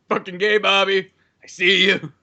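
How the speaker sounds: beer-drinking father. Acted. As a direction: jeer at your son as a drunk dad. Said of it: "a father that was just always sitting there drinking beer".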